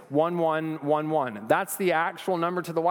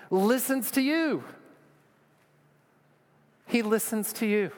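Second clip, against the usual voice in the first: soaring preacher peak; 7 semitones higher than usual. Real, not acted. Preacher tells church God listens, ringing high. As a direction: lift it up like a revelation.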